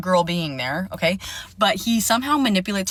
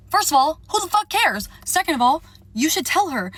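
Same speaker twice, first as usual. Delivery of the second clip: outraged rant; 8 semitones higher than usual. Real, not acted. Venting to viewers about the silent friend, high and outraged. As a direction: fire it back high and fed up at the friend.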